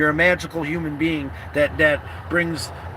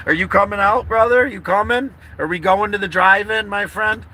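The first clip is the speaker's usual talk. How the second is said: higher, eager call to a friend; eager invitation; 5 semitones higher, 5 dB louder than usual. Real, not acted. Asking a friend along to the drive-in, eager. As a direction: invite him along with a rising, hopeful lift. Calling a friend to join him.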